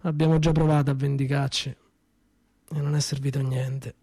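usual speaking voice

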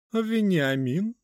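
high, slow, mannered lady. Acted. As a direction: call out in a lofty mannered tone. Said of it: "высокий манерный голос".

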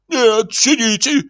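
high, drawn-out desperate cry; shouting. Acted. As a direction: shout to stop her standing. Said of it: "закричал Издурёнков". (высокий отчаянный крик)

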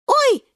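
very high, loud squeak; short high squeal of shock; startled yelp. Acted. Шпинатик diving into the river, high yelp. Falls into the water.

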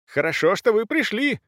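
high-pitched urgent cry; worked-up countess calling out; agitated exclamation. A narrator's reading. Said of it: "воскликнула Брунхильда".

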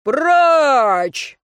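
drawn-out booming command; long, loud clear-the-road shout; thundering. A narrator's reading. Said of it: "загрохотал за их спинами мужской голос".

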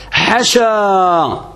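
حشا very slow, short a after ح, long a after ش; حشا, clipped at the start and drawn out at the end; form without the first long vowel. Shown as a demonstration. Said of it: "حذف الألف الأولى فتقول".

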